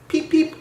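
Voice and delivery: high peep peep; whistle peeps. A narrator's reading. Edward whistling at the station, high peeps. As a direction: toot like a small engine. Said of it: "whistled Edward".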